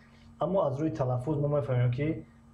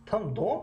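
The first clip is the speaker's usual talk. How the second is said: дом raised higher, rising; higher rising там дом; stress on дом; 7 semitones higher than usual. Shown as a demonstration. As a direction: lift дом up sharply. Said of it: "мегӯем, ки".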